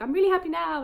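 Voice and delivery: imitating a delighted bride, high and loud; retold happy exclamation. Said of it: "she's really happy now".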